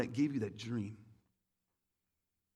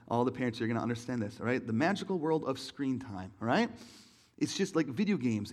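normal talking voice

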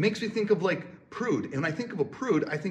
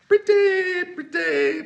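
high pitched; 14 semitones higher, 8 dB louder, much slower than usual. Real, not acted. Building up to pretty good.

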